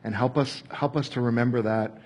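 soft, quiet prayer voice; closing prayer. Acted. Pastor to God for the church, soft prayer. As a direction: pray gently over the group.

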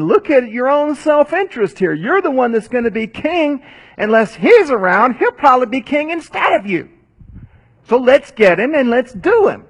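high, pushy king urging his son; urgent Saul. Retold or imitated. Saul urging Jonathan to kill David, high and insistent. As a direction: press the point, voice climbing high.

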